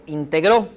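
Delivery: integró said as in-te-GRÓ; stress on last syllable. Shown as a demonstration. Teacher reading the third example, stressing the end of integró. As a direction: land on the final ó of integró. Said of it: "la lectura nos dará la pauta".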